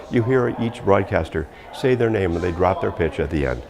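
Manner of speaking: usual speaking voice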